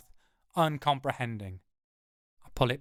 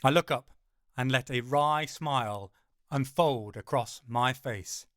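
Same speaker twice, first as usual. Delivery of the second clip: plain reading voice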